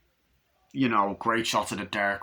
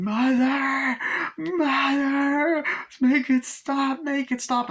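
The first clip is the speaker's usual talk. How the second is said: terrified pleading; 5 dB louder, much slower than usual. Retold or imitated. Girl begs as bed shakes.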